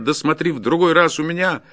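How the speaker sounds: loud, high-pitched warning; forester's angry parting shout; shouted threat. A narrator's reading. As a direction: yell a warning after him. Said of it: "закричал ему вслед".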